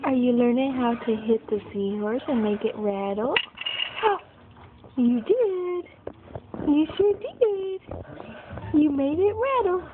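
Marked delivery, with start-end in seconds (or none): 3.4-4.2 soft breathy gasp of delight. Real, not acted. Mother thrilled by baby's rattle, soft gasp. 5.0-9.8 excited praise. Real, not acted. Praising baby's success.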